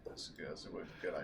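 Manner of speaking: soft whispered aside; whispering. Real, not acted. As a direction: breathe it under your breath. Aside while GM describes pups.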